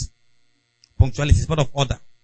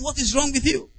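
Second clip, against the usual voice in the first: mock shout; 10 semitones higher, 4 dB quieter than usual. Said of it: "you are shouting".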